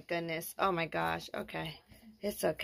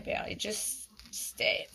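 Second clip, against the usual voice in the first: ordinary delivery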